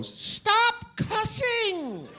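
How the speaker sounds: put-on very high, slow nagging kid voice; prissy nerd kid. Nerd of the group scolding his friends, high and slow.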